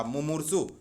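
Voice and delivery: ordinary talk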